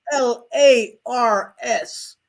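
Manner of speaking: slow, letter by letter; spelling out letters. Shown as a demonstration. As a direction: spell each letter deliberately. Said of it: "Their name is spelled out with".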